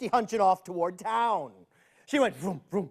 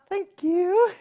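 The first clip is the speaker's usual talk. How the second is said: put-on little girl; 10 semitones higher than usual. Girl thanks storekeeper.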